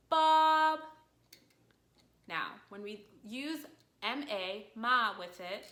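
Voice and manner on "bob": "Bob" held long on one high, level pitch; high monotone "Bob"; first tone. Shown as a demonstration. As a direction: sustain "Bob" high and even, loudly. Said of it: "our first tone was".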